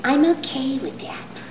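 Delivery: slow, soft, overly calm condescension; patronizing spiritual type. Retold or imitated. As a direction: soothe her with smug gentleness. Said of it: "people who like to tell you".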